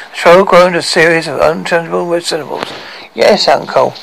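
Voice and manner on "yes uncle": ordinary delivery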